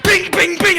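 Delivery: loud high "bing" sound effects; imitated bouncing noise. Iggy back on his feet, punched bouncing effects.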